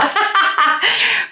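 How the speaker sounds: high burst of giggling laughter; a real giggle that breaks out high. Real, not acted. Host cracking up at her own God impression, high giggle.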